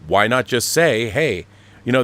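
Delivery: normal talking voice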